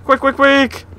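high, urgent rapid repeats; urgent hurry. Real, not acted. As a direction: rush yourself out loud. Rushing in game.

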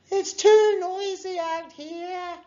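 very high, loud, slow call; high-pitched drawn-out holler; crying out. A narrator's reading. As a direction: shout over outdoor noise. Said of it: "cried Mags".

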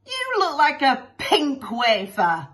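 loud, high heckle; shouted jeer. Acted. Joker mocks the pink costume, shouted jeer. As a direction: call it out from the back. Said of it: "shouted a joker from the back".

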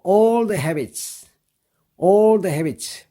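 very slow, each word laid out for learners; slow model reading. Shown as a demonstration. Teacher to students, very slow model reading. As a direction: read it out slowly for students to copy. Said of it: "발음 연습을 해보겠습니다".

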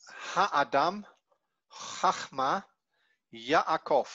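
Ha'adam, Chachma, Yaakov, each first vowel held in full; Ha'adam, Chachma, Yaakov spoken deliberately, with a slight pause after the first vowel; full vowel pronunciation. Shown as a demonstration. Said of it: "ensuring that the vowel receives its full pronunciation".

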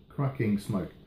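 usual speaking voice

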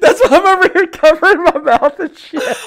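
high, loud, laughing hard; can't stop laughing while talking; cracking up. Real, not acted. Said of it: "cracking up".

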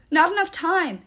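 loud, high urgent shout; screamed. A narrator's reading. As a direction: shout over the danger, no time to explain. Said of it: "Nico screamed".

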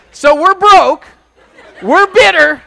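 loud, high laughing punchline. Real, not acted. Preacher teasing his church, loud laughing. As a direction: laugh through the joke. Joking about city with laughter.

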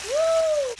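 high, loud whoop of delight; excited whoop. Real, not acted. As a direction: belt out a happy whoop. Chicken floats to top.

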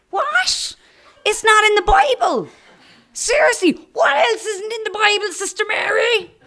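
high, loud, incredulous teasing; excited schoolgirls. Retold or imitated. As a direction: pile in on the nun with glee. Girls mocking the nun.

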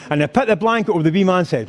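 ordinary talk

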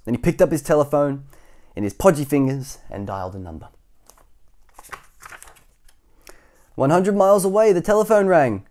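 dramatic storybook telling, lifting high; story narration. A narrator's reading. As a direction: raise the voice for the scene change.

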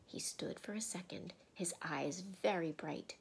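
ordinary delivery, nothing marked

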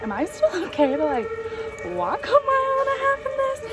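high, timid squeak of a question; mock-nervous squeaky voice; retold anxious question. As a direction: ask it like a worried little kid. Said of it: "I, like, ran upstairs and, like".

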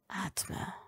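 soft whispered breath of a word; hushed urging whisper; quiet. A narrator's reading. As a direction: breathe it gently. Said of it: "forderte Blaze leise".

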